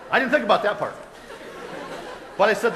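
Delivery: real laugh while talking. Real, not acted. [name] laughing at himself in front of the congregation. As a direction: chuckle through the words. Laughing at his own answer.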